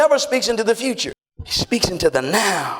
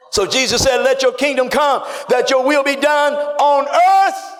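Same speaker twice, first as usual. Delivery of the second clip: preaching at full force; 6 semitones higher than usual. Acted. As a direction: build each word toward the top. Preacher rising in pitch.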